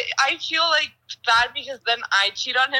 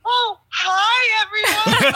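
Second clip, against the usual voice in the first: bright, loud greeting, nearly laughing, much higher; excited hello; 10 semitones higher, 4 dB louder, much slower than usual. Real, not acted. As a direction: burst out a happy hello to the listeners. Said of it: "so say hi".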